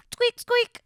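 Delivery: very high squeaky toy sound; high squeak. Pretend. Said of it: "instead it would go like".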